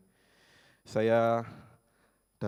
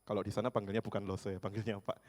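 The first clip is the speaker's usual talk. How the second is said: ordinary talk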